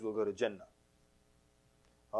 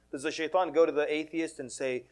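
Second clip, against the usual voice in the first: ordinary delivery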